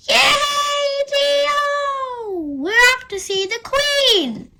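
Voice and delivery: excited cheer. A narrator's reading. Children cheering on the bus.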